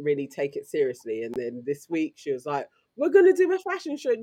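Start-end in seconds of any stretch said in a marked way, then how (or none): 3.0-4.1 high, fast and louder, bursting with news; excited announcement. Retold or imitated. Sister announcing the fashion show, high and fast with excitement. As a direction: gush it out like big news.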